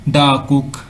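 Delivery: "the cook" pronounced "daa cook". Shown as a demonstration. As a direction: open aa in "the" before "cook". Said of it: "इस तरह से जो है हम इसको प्रोनाउंसिएट करेंगे".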